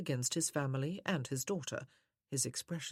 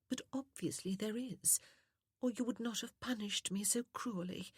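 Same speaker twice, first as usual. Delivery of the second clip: soft, sweet woman; 7 semitones higher than usual. A narrator's reading. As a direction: reproach him in a sweet, wounded feminine voice. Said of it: "how soft and sweet her voice was".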